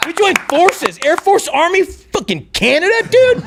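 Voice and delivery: rising into a high whoop; excited cheer. Retold or imitated. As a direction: hype it up like a victory chant. Drunk victory cheer retold.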